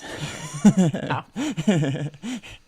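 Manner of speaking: high burst of laughter; loud laugh. Real, not acted. Laughs at grim series.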